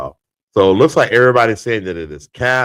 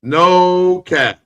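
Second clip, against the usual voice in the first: much higher and louder, hyped up; hyped declaration; 11 semitones higher, 6 dB louder than usual. Real, not acted. Host hyping his no cap verdict to the stream, excited. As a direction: declare it like a victory.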